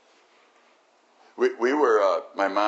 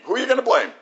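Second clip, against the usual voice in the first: normal talking voice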